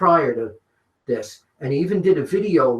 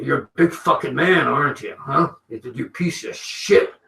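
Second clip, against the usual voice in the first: lightly voiced gruff wrestler hurling insults; a hint of a raging wrestler, slightly louder; Randy Savage; 4 dB louder than usual. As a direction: menace him in a rough put-on voice, loud at the end. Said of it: "worst Savage impression I've ever".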